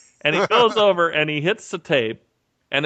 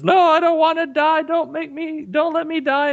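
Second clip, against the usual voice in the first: mock terrified plea; 13 semitones higher than usual. Actor playing the condemned man begging, hammed-up high panic. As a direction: squeal like a condemned man faking terror. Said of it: "he says".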